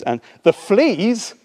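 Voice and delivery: high, slow disbelieving question; can't believe it; incredulous. Acted. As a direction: Corrie aghast at thanking God for fleas, incredulous. Corrie incredulous.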